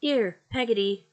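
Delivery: loud, high call through the door. A narrator's reading. Aunt calling the servant down the passage, loud. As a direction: summon the servant sharply. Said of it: "cried Miss Betsy".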